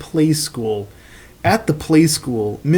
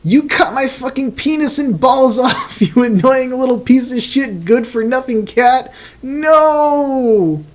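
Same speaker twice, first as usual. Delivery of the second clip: high hysterical yell; 9 semitones higher than usual. Acted. Caillou screaming at Gilbert in high hysterical agony. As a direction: shriek the insults up high.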